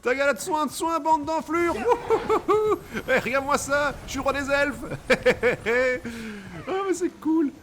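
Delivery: high, loud gleeful shouting; triumphant whooping. Real, not acted. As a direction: crow with joy while riding. Riding the stag triumphantly.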